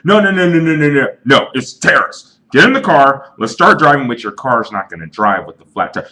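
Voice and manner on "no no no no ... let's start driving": high, loud and wild-eyed, shouting over everyone; a paranoid buddy yelling that it's terrorists; hammed-up hysterical conspiracy rant; frantic crazy friend. Crazy friend refuses flat tire.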